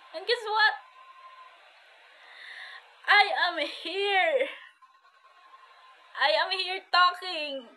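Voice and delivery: high, lifted, emphatic declaration; triumphant testimony. Real, not acted. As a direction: announce it with rising joy, stretching the words. Triumphant turn in her testimony. (masayang pagpapatotoo, mataas ang boses)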